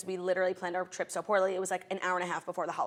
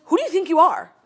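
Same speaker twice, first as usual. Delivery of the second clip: loud, high mock outrage; yelling customs agent; 8 semitones higher, 12 dB louder than usual. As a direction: imitate an official bawling someone out. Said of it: "he was yelling at me".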